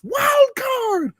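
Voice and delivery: high, frantic mock panic, loud; panicked [name]. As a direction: yell in cartoon panic as the brakes fail.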